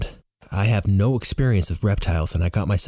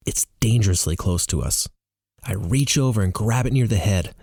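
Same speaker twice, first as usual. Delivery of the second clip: ordinary delivery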